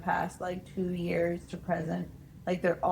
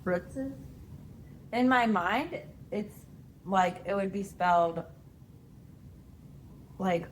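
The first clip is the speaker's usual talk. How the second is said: ordinary talk, nothing marked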